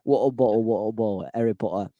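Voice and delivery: mock British warm-up phrases; British accent. Shown as a demonstration. Warming up for the British reading, mock British accent. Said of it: "I gotta say it in a British accent".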